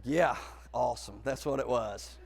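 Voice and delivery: low, soft, worn-out deadpan; drained agreement. Retold or imitated. Man to the girl after the ride, drained agreement. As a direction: agree quietly and wearily.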